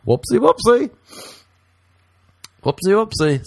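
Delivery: squeaky high whoopsie whoopsie; sing-song it up high; silly high whoopsie. Pretend. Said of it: "I'll be like".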